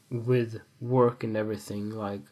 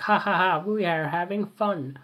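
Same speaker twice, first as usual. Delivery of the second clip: hollow fake laugh, pitched up; higher, fake-merry party voice; mock cheer; 7 semitones higher than usual. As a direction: pretend to have fun, emptily. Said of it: "You're like".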